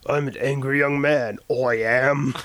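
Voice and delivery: mock British angry young man accent; hammed-up British accent boast. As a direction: declare it in a put-on British accent. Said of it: "That's my British accent".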